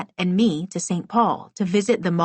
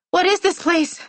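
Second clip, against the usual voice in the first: high frightened squeak; 12 semitones higher, 4 dB louder than usual. A narrator's reading. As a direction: squeak out in fear. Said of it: "I squeaked".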